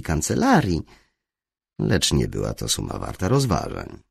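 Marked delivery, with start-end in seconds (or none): none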